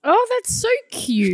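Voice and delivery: cooing. Real, not acted. Cooing at his confession.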